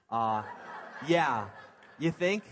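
laughing sarcastically. Real, not acted. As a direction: laugh through it, wry. Laughing at awkward moment.

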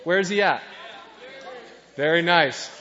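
usual speaking voice

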